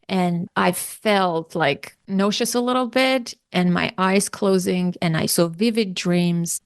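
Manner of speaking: ordinary delivery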